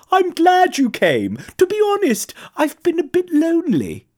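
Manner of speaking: high-voiced giraffe welcoming a visitor; friendly, lonely giraffe. A narrator's reading.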